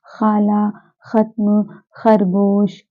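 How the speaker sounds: खाला, खत्म, खरगोश each begun with scraped x; Persian kh. Shown as a demonstration. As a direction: read खाला, खत्म, खरगोश slowly apart with throat-friction kh. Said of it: "इसके नीचे बिंदी लगी होती है".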